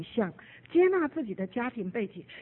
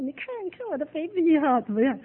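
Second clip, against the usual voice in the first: proud parent; 6 semitones higher, 4 dB louder than usual. Retold or imitated. Parent showing off baby.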